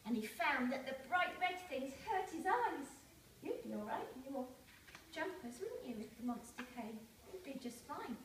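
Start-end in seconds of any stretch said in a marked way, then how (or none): 3.4-8.2 soft aside. Real, not acted. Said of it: "aside to the listening children".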